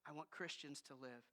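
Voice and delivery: slow, low and soft; quiet firm God. Retold or imitated. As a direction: slowly lay down the rule, hushed. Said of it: "He says".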